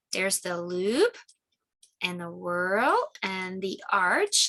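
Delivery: slow, deliberate naming; name each one slowly for kids; slow teaching. Shown as a demonstration. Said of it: "teaching fingerprint types slowly".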